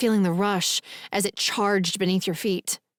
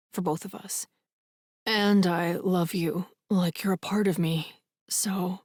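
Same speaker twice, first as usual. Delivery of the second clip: a hint of a gentle, loving reassurance; Jake's tender voice; 4 semitones lower, 4 dB quieter than usual. A narrator's reading. Jake forgiving Naomi, lightly voiced, soft and tender.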